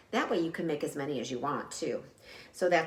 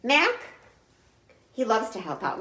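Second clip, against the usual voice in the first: usual speaking voice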